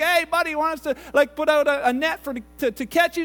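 calling up. Acted. Two guys in canoe offering a net, calling up. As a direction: hail someone stuck overhead.

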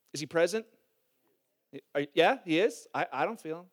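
ordinary talk, nothing marked

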